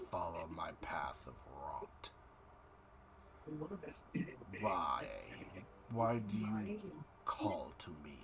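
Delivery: low gurgling deity voice; eldritch horror. Acted. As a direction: speak as a rotting god from a bog. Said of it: "you hear a voice, almost as if gurgling from a bog of decay".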